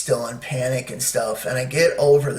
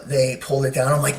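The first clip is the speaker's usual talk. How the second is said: normal talking voice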